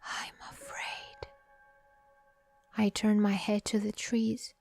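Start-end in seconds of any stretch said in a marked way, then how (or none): 0.0-1.3 slow, very soft monster whisper; monstrous whisper. A narrator's reading. Unseen monster whispering in a soldier's ear, eerie whisper. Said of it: "horribly monstrous voice whispering in my ear".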